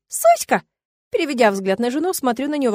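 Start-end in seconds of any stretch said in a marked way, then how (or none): 0.1-0.6 put-on very high, squealing little boy; toddler's shriek. A narrator's reading. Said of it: "внук Тины вскрикивает". (визг малыша)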